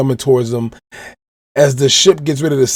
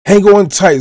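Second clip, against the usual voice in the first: high loud scream; 7 semitones higher, 4 dB louder than usual. Retold or imitated. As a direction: scream it over the wind. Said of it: "Zoro screams out".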